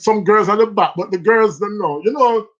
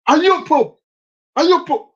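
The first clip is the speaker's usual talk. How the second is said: higher, louder accusing question; accusing teacher; 7 semitones higher, 4 dB louder than usual. Retold or imitated. Big woman at school accusing the boy, pressing hard. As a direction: press the child like a suspicious teacher.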